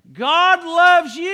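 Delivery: high, loud, slow proclamation; ringing preacher's cry of God loves you; emphatic declaration. Acted. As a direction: proclaim it to the whole room. Preacher declares the key line.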